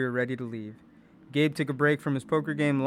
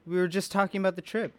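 sweet mom voice; 7 semitones higher, faster than usual. A narrator's reading. Mom voice to Gabe.

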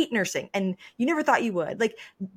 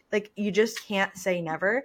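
ordinary talk

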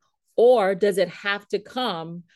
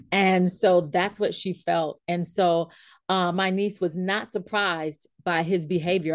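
ordinary delivery

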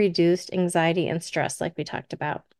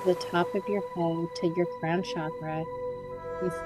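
guided meditation; 7 dB quieter than usual. Acted. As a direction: gently guide them into calm relaxation.

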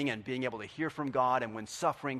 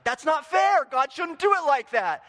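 much higher, louder mock protest; a hammed-up listener protesting; indignant complaint; 14 semitones higher, 11 dB louder than usual. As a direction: whine that it isn't fair. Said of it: "You're like".

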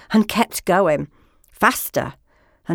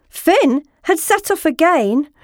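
ordinary delivery, nothing marked

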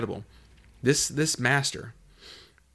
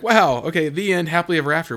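higher, louder mock relief, fast at the end; relieved wow; 6 semitones higher, 8 dB louder than usual. Preacher voicing listeners' relief at a happy ending, mock cheerful. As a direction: play the naive happy-ending reaction. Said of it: "we'd like to be like".

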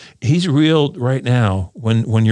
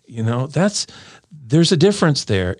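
normal talking voice

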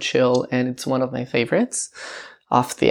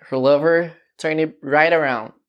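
ordinary talk, nothing marked

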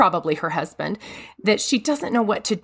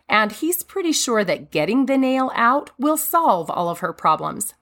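ordinary delivery

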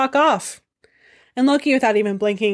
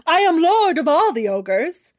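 higher, grandly announced; pompous ogre lord; 7 semitones higher than usual. Acted. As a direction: proclaim it like royalty. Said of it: "he is Thrym".

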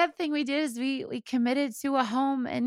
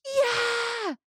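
put-on excited toddler yell; 13 semitones higher than usual. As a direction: shout yes like a thrilled toddler. Son excited about balloon.

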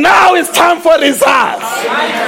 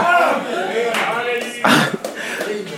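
a short, soft laugh, well below speaking level; 4 semitones lower, 10 dB quieter than usual. Real, not acted. Pastor laughing at his own point, softly. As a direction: chuckle at the congregation. Preacher laughs mid-sermon.

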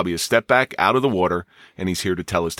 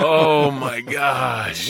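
long drawn-out, high exclamation; amused shock; 4 semitones higher, much slower than usual. Real, not acted. Host reacting to a colleague's outrageous punchline, amused shock. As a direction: stretch every word out in laughing disbelief.

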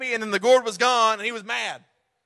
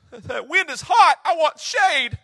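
higher, louder, slow complaining shout; whiny Jonah; 4 semitones higher, 5 dB louder, slower than usual. Acted. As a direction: whine like a spoiled child.

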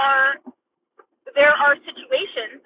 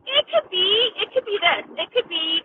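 ordinary talk

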